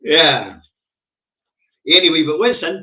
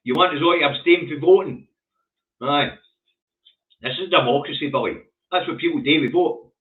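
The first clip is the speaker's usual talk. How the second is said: usual speaking voice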